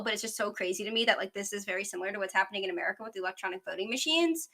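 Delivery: rapid-fire, words tumbling along; talking too fast. Real, not acted. Host excited by Brazil parallels to America, talking too fast. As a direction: rush through it in one quick run. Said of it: "I'm sorry if I'm talking too fast".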